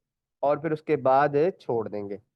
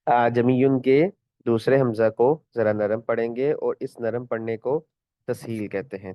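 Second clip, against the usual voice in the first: usual speaking voice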